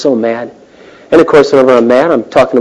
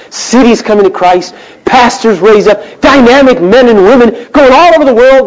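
building excitement; 10 semitones higher, 3 dB louder than usual. Real, not acted. Preacher's rising vision.

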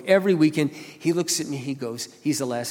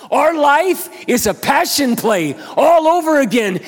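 high, loud, slow sermon shout; full-force preaching; 12 semitones higher, 10 dB louder, much slower than usual. Acted. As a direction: drive it home from the pulpit, slow and loud.

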